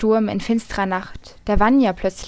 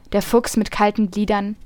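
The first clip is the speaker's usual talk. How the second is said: ordinary delivery, nothing marked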